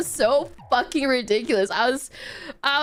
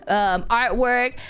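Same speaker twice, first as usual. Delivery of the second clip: usual speaking voice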